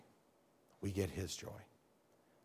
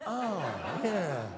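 sheepish realization; 5 semitones higher than usual. Retold or imitated. The other driver's embarrassed realization.

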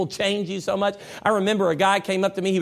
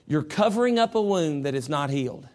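usual speaking voice

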